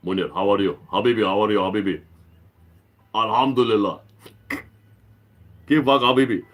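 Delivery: a hint of a low character voice; Munir voice. Shown as a demonstration. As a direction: lightly voice a character greeting a dear friend. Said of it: "this is my Munir voice".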